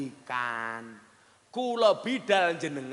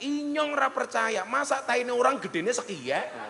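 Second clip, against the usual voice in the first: higher, laughing shout; mock yell in disbelief; 7 semitones higher than usual. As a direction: cry out in comic disbelief, slowly. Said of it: "Orang ngapaknya langsung teriak". (mbengok ora percaya karo ngguyu)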